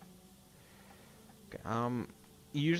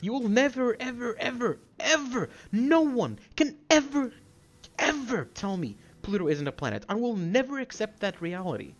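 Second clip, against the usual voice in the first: high, emphatic indignation; heated tirade at raised pitch; angry rant; 6 semitones higher than usual. Real, not acted. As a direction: insist furiously, punching key words. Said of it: "I did not expect to get this angry".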